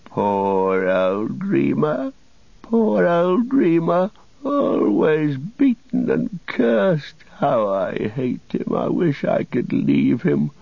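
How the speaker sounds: high, slow, drawn-out wail; whimpering. A narrator's reading. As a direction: whine pitifully in a thin high voice.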